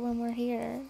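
normal talking voice

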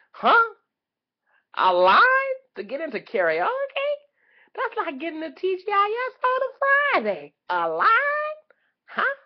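high, loud incredulous outburst; loud disbelief. Acted. Comic astonished at a karaoke queue, loudly incredulous. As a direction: climb into an astonished high holler.

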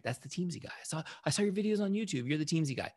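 high-voiced mock fan excitement; excited fans. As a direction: mimic people thrilled to spot someone they know. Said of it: "Everyone was excited about it".

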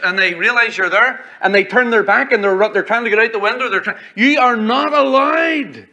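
high-pitched, insistent preacher's delivery; forceful preaching. Real, not acted. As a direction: press the warning home from the pulpit.

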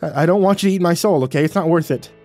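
yelling. A narrator's reading. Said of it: "beneath me and yelled".

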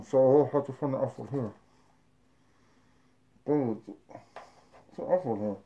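speaking with packed upper lip; muffled lips around pouches; mouth full of snus. Real, not acted.

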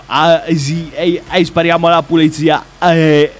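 loud brash gangster imitation. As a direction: play it like a street thug. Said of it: "faccio tipo".

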